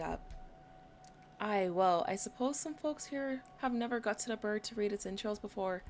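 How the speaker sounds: mild Irish lilt throughout; light Irish accent, amused. Acted. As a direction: keep the Irish voice going in the chat. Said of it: "doing an Irish accent during this playthrough".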